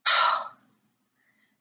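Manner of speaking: long tired unvoiced sigh; weary sigh. Real, not acted. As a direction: let out a drained sigh. Worn out by the call.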